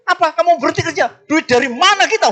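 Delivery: high, loud and fast panicked cry; panicked wife. Retold or imitated. Wife hearing her husband quit his job, panicked shout. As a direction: shriek it in alarm.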